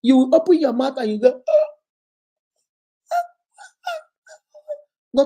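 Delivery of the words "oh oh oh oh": thin, strained oh's far above the normal voice, struggling to speak; voice failing to come out. Pretend. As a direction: squeak out empty oh's as if your voice is stuck. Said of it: "Nothing will come out".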